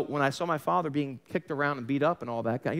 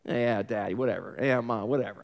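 put-on sarcastic teen; 6 semitones lower than usual. Teen son brushing off his dad and mom, sarcastic. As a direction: toss it off like a bored fourteen-year-old. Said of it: "little cutting and sarcastic remarks".

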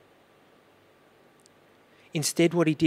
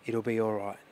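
soft, lower comforting words; tender reassurance; 4 semitones lower, 4 dB quieter than usual. Retold or imitated. Father comforting his panicking baby daughter, soft. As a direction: soothe a frightened child.

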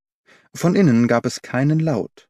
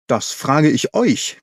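slow, drawn-out indignant screech; mild screech; 3 semitones higher, slower than usual. A narrator's reading. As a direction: screech it at the intruders, held back. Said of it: "kreischte er".

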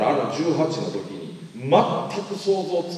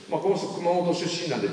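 usual speaking voice

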